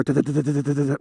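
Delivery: rapid run of da-da-da knocking; imitated door knock. Officer arriving at the house, imitated knocking. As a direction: mimic knocking with quick spoken beats. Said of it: "he knocks on the door".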